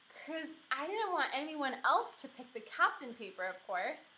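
ordinary delivery, nothing marked

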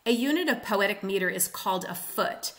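ordinary talk

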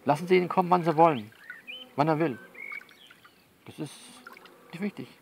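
usual speaking voice

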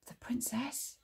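hushed, high questioning echo; soft, astonished question; surprised. A narrator's reading. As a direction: repeat it softly in surprise, rising at the end. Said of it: "repeated Aladdin in surprise".

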